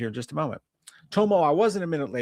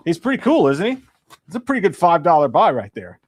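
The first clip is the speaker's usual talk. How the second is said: higher and louder, thrilled with the dinosaur; excited pride; 4 semitones higher, 8 dB louder than usual. Real, not acted. Vlogger to viewers, showing off his dinosaur, excited.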